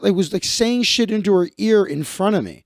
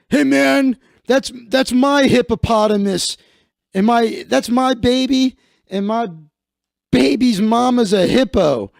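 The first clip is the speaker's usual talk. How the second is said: higher, louder hammed-up tough-guy whine; mock jealous tough guy; 5 semitones higher, 4 dB louder than usual. Mocking the attacker who punched a stranger over his girlfriend, hammed-up higher and louder. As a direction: lampoon him bragging about his girl.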